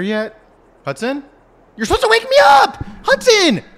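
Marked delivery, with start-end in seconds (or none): 1.8-3.6 angry shout. Pretend. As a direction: yell it, fed up and shrill. Pretend sleeper yells at son.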